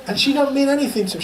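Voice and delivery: ordinary delivery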